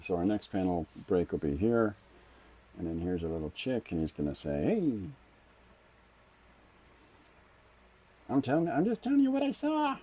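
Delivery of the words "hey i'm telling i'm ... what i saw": much higher squeaky put-on girl voice; Lucy voice. Artist voicing the chick character in a much higher put-on Lucy voice. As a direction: pitch it way up, insistent and innocent. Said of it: "that's my Lucy voice".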